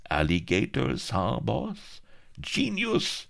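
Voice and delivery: high, rising fawning cry; terrified creep crying out. A narrator's reading. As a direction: squeal with nervous praise. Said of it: "cried the terrified creep".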